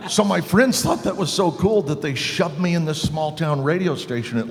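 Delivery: normal talking voice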